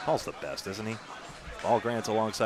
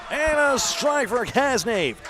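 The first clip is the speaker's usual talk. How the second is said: excited call; 11 semitones higher, 4 dB louder, much slower than usual. Real, not acted. Commentator calls a strike.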